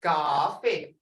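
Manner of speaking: "Coffee" with its first vowel held; dragged-out vowel. Shown as a demonstration. Teacher asking students if it's long, dragged-out vowel. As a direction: drag the "ah" in "Coffee". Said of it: "It's dragged out".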